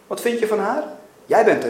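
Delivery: loud, insistent prodding; forceful challenge. A narrator's reading. God demanding Mackenzie judge a child, loud and insistent. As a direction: hammer the questions home.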